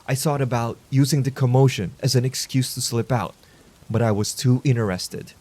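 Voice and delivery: ordinary delivery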